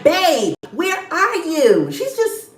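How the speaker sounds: put-on slow, needy girlfriend voice; imitating Ashley. Mimicking Ashley calling her man on the phone. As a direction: coo it like a clingy girlfriend. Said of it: "That's exactly how she sounds".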